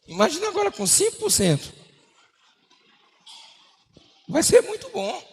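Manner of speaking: high, dreamy imagining of the raise; picture the good pay with glee; daydreaming delight. Retold or imitated. Worker imagining his raise, delighted and high.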